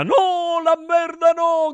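high-pitched drawn wail of protest; high theatrical outcry; shouted protest. A narrator's reading. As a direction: cry out in mock dismay. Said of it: "gridarono gli dei".

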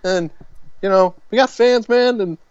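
high, light American accent impression; imitating Tom Holland's American accent. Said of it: "I'm doing his American accent".

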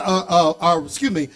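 usual speaking voice

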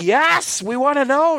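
eager fan. Retold or imitated. Eager fans answering Lucas.

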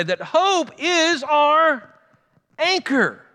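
"hope is our" drawn out very slowly and high, landing on "anchor"; stressed point. Acted. Pastor to church, drawing out the key point. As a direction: stretch each word toward "anchor".

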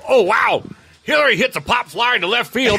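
loud, high sportscaster impression; Harry Caray. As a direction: shout the play-by-play like an excitable announcer. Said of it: "just doing Harry Carey all night".

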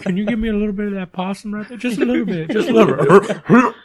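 high, whiny, half-laughing begging; pleading creature voice. Retold or imitated. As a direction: beg for a bite in a silly voice. Quoting the possum-begging character.

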